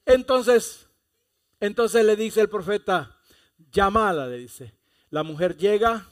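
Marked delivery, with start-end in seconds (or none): 0.1-3.2 loud preaching. Real, not acted. Preacher builds to a loud point. 3.7-4.3 loud command. Acted. Prophet's command voiced loudly.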